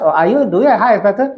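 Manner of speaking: fast, brisk question; quick retold question. Retelling what staff ask patients, fast question. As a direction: rattle off the question. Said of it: "they ask".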